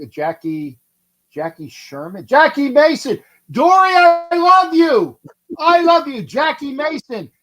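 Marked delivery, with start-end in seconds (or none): 2.3-7.3 loud, high, drawn-out exclamation; elated shout. Real, not acted. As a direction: burst out with overjoyed relief. Delighted at recalling the forgotten name.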